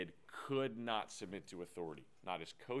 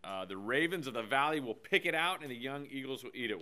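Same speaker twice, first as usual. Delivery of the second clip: scripture reading; 5 semitones higher, 12 dB louder than usual. Acted. Reads scripture aloud.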